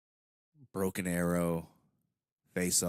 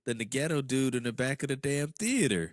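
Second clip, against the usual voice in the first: raised, loud comic scolding; mock exasperation; 5 semitones higher, 4 dB louder than usual. Friend replies to a flood of texts, mock-exasperated. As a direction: scold your friend at raised pitch, half joking.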